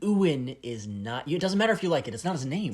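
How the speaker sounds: loud, high exasperated correction. Real, not acted. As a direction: raise your voice in disbelief.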